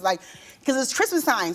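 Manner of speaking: ordinary talk, nothing marked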